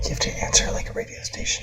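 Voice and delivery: hushed aside; whisper. Real, not acted. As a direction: whisper it so the caller can't hear. Whispered aside during phone call.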